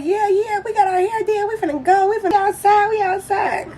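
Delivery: high, excited mock girl voice; teen girls hyped to be seen, mock excited voice. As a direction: play the giddy girls ready to go out. Mocking hyped-up friends getting ready.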